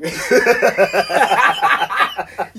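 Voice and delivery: loud, high burst of laughter; real helpless laugh; big laugh. Real, not acted. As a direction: crack up mid-story. Laughing at cop's joke.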